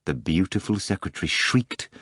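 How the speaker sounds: ordinary delivery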